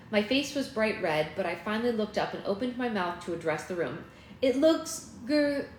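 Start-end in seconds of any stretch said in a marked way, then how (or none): 4.4-5.7 high, slow words cut short; choked up. A narrator's reading. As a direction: force cheer until it breaks off. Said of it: "interrupted by a choked sob".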